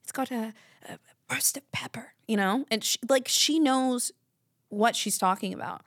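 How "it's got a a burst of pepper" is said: hushed connoisseur impression, half whispered. Imitating a singer on a wing show, hushed connoisseur. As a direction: murmur it like a taster weighing flavours. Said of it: "And she's like".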